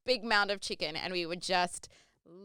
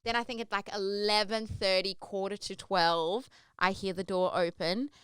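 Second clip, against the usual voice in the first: ordinary delivery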